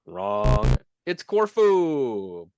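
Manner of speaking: loud, drawn-out quiz host reveal; playfully over-announced answer; mock game-show verdict. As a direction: boom the wrong-answer verdict, stretching it out.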